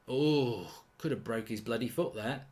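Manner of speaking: ordinary delivery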